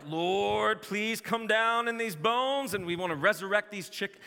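high, loud, slow mock-fervent prayer; mock prayer to raise chickens. Pastor mimicking himself praying over chicken wings, hammed-up and fervent. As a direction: ham up a crazed preacher pleading over chicken bones. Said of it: "And I'm just like".

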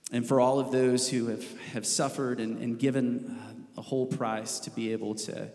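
earnest prayer, slowing and softening to the end; spoken prayer. Real, not acted. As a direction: pray aloud for the whole church. Elder leads congregation in prayer.

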